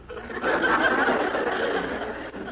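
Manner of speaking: a short breathy chuckle at her own joke; amused laugh. Acted. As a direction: laugh off the jab at Dennis.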